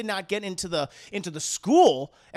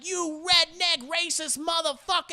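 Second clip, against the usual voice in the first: high mock cussing; 10 semitones higher than usual. Coach cussing ref.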